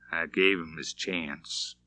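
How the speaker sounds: soft, slow, sorrowful murmur; sad murmur. A narrator's reading. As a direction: murmur it heavy with sadness. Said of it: "Shane murmured out of the depths of a great sadness".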